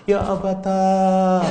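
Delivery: "Ya abata" wailed high, loud and slow; mourning cry on "abata"; regretful wail. Shown as a demonstration. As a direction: stretch the final a of "abata" into a lament. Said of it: "nanti kalau menyesal itu".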